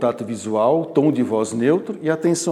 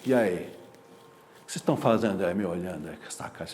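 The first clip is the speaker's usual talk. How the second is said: arrogant challenge; 8 semitones lower, 8 dB quieter than usual. Shown as a demonstration. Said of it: "se eu chegar invertido".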